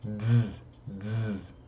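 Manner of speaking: short, soft buzzed "dz". Shown as a demonstration. Teacher demonstrating Latin Z, softly buzzed "dz". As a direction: isolate "dz", a D released into Z. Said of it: "tem o som de D Z".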